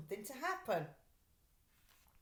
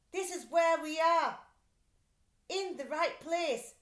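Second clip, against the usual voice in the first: higher, louder boastful crook; gruff thief voice; 7 semitones higher, 6 dB louder than usual. Acted. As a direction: swagger like a proud art robber. Thief character voice.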